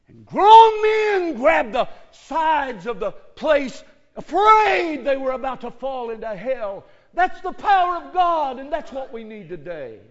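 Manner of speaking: fiery preaching, building to a shout. Real, not acted. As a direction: thunder it from the pulpit. Preaching at full force.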